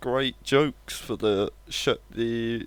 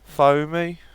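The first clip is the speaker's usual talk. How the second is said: Brummie punchline, higher and louder; Birmingham accent; 6 semitones higher, 6 dB louder than usual. Retold or imitated. Streamer delivering the punchline in a Birmingham accent. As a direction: hit the joke in a Brummie voice. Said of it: "How do people from Birmingham like their coffee".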